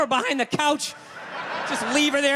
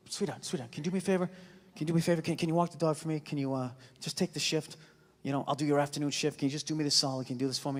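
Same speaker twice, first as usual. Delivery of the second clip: soft, sweet begging; pleading softly; 9 semitones lower, 9 dB quieter, faster than usual. Retold or imitated. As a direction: coax her gently, much softer. Begging wife for a favor.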